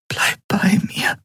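slow, pleading whisper; hushed, drawn-out plea. A narrator's reading. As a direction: whisper it to a loved one. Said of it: "flüsterte sie".

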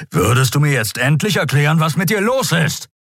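gruff exasperation, pitch climbing high; snarling demand. Acted. Keru demands Endriel explain herself, snarling. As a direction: demand answers, rising to the end. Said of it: "fauchte Keru".

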